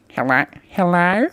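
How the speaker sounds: high, slow cutesy hello; weird couple voice. Shown as a demonstration. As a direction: stretch out a silly high greeting. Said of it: "I do this weird one".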